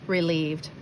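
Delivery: usual speaking voice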